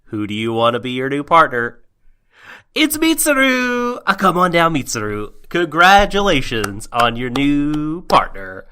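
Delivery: loud, high game show announcer; booming prize announcer. Pretend. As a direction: call out the winner like a TV host. Mock game show host.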